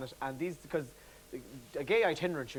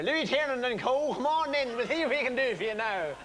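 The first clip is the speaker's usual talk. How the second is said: Ballinrobe solicitor; 7 semitones higher, 4 dB louder than usual. Acted. Comic imagining his son as a one-eyed solicitor, hearty solicitor voice. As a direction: put on a booming, high-pitched solicitor welcoming a client in. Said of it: "sounds like a solicitor from Ballinrobe".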